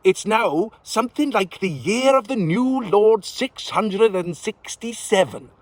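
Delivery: put-on high, slow old witch, full of pity. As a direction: tell him gently like a pitying crone. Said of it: "looked at him with pity".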